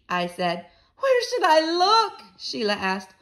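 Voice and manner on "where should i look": high, loud, slow whine; upset girl. A narrator's reading. Girl told to find the teeth, wailing. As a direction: wail the question like a flustered child.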